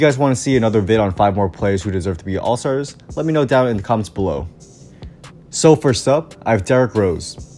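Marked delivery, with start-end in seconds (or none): none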